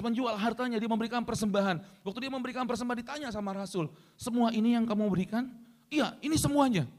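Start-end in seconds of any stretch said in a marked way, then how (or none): 4.2-5.5 lightly acted, higher-pitched questioning; questioning apostle. Apostle questions Ananias about his offering, lightly acted questioning. 5.9-6.9 high, slow mock assurance; insistent Ananias. Ananias answers the apostle about his offering, acted insistence.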